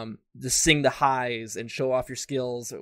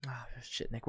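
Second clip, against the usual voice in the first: whispered under the breath, much softer; a real whispered slip of annoyance; whispered aside; 13 dB quieter than usual. Real, not acted. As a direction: mutter the curse to yourself in a whisper. Losing his train of thought.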